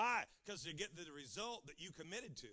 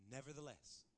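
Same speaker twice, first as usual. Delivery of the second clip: quiet; 6 semitones lower, 8 dB quieter than usual. Acted. Soft emphasis.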